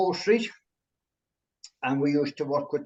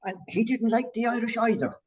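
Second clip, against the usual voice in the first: ordinary delivery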